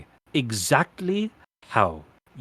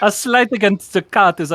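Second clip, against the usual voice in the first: laughing; 8 semitones higher, 8 dB louder than usual. Acted. Queen laughing at the slight.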